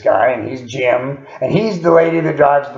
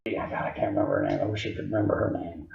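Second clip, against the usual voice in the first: breathy whisper, much lower and softer; half to himself, whispering; whispered aside; 4 semitones lower, 14 dB quieter, faster than usual. Real, not acted. Muttered aside while recalling.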